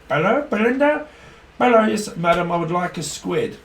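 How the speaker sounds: high, loud put-on customer voice; mock posh shopper ordering a squid. Customer phoning the fishmonger, high and hammy. Acted shop customer call.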